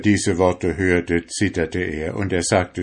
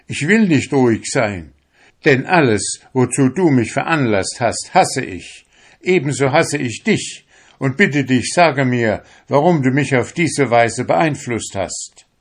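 raised, higher voice of protest; crying out angrily; 5 semitones higher, 5 dB louder than usual. A narrator's reading. Fisherman rejects his soul and demands answers, raised angry voice. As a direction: protest hotly, louder and higher. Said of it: "schrie der junge Fischer".

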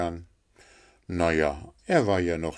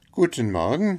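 higher greeting with a rising end; small fellow's high-pitched hello; friendly little Hans; 8 semitones higher, 5 dB louder than usual. Acted. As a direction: answer in a high, eager voice. Hans answers the miller.

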